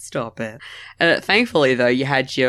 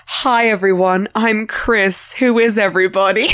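higher, over-bright comic greeting; mock-cheery introduction; 7 semitones higher than usual. Comedian walking naked into a family area, mock-cheery. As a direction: imitate a comedian greeting strangers too eagerly. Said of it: "has that exact vibe to it".

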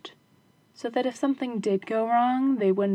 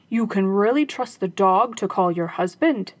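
robber's voice; 2 semitones lower, 6 dB louder than usual. Acted. Robbers ask.

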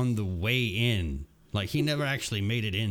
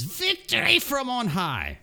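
higher, slow, a hint of a heroic voice; half-done character voice; 12 semitones higher, 3 dB louder than usual. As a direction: start the voice, then drop it. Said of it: "I'm not gonna do the voice".

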